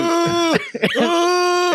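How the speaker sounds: winded groan. Retold or imitated. Rider re-enacting a handlebar crash, winded groan. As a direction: let out a high, pained wail after impact. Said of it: "I hit and I let out".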